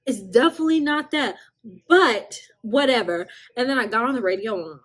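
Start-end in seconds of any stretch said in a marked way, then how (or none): 0.0-3.3 dismissive. Retold or imitated. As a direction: brush him off with a sassy hum. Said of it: "And I said".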